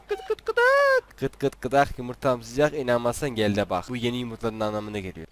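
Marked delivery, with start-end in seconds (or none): 0.1-1.2 slow, very high clucking hen; chicken clucking. Pretend. Gamer clucks for his flying chicken, high and slow.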